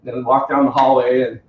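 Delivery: normal talking voice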